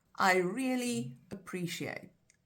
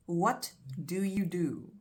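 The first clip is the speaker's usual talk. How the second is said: slow demo phrase; slower than usual. Shown as a demonstration. Teacher demonstrating an English phrase to learners, slowly. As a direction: slowly, stress on you, falling at the end. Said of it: "that is often used as a phrase".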